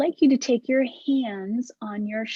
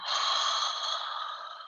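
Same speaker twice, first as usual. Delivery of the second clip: a voiceless, airy ha sigh; noisy ha breath out; ha exhale; 6 dB quieter than usual. Shown as a demonstration. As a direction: let the breath fall out on a ha. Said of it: "You could try the H A sound".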